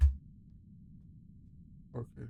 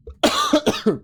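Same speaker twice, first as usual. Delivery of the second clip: sudden harsh cough; loud cough; 12 semitones higher, 10 dB louder than usual. Real, not acted.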